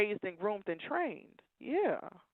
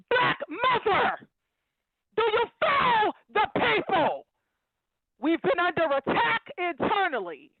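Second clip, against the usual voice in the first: emotional yelling; 7 semitones higher than usual. Real, not acted. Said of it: "I'm sorry for yelling. I'm emotional".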